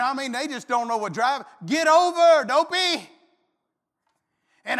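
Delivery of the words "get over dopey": loud, higher yell at a slow driver; mock road-rage yell. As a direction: shout it out the car window. Yelling at drivers on interstate.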